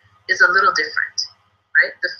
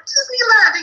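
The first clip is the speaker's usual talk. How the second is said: high mock squeal of delight; excited kids; 12 semitones higher, slower than usual. Teacher plays kids echoing parent, thrilled. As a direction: echo it like a thrilled child. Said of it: "And the kids are like".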